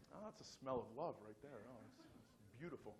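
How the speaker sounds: soft, low, lingering appreciation; mock savouring. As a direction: savour it softly, tongue in cheek. Mock savouring of restroom smell.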